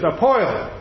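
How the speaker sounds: "poil" said with a mild New Jersey vowel, oy for ear; light Jersey "poil"; New Jersey accent. Retold or imitated. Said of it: "I think he's from New Jersey".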